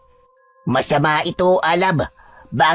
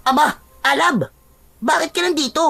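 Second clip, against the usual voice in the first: startled greeting, pitch raised; surprised. Acted. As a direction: exclaim at finding a friend unexpectedly. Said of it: "nagulat ito nang makita siyang". (gulat na pagbati sa kaibigan)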